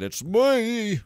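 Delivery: high, loud, held ME; exuberant self-announcement. Real, not acted. Player boasting about spotting silliness, exuberant cry. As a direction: sing out the last word loudly.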